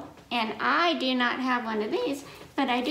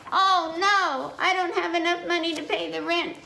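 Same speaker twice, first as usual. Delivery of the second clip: high, louder dismayed cry; dismayed farmer; 8 semitones higher, 4 dB louder than usual. Acted. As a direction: wail over an empty purse. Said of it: "looked at her purse and said".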